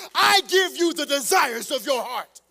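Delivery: loud, rising preacher cadence, hammering each phrase; preaching at full force. Acted. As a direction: preach it to the back row.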